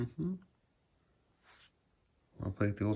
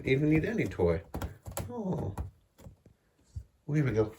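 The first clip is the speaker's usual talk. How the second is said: high, sing-song cooing to a cat; 7 semitones higher than usual. Real, not acted. Owner cooing high baby talk to her cat Boo Boo.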